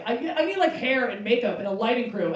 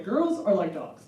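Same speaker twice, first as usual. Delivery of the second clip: normal talking voice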